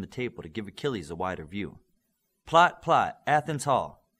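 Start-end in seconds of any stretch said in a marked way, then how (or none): 2.5-4.1 calling out a command to the system; loud voice command. Acted. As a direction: speak up so the device hears.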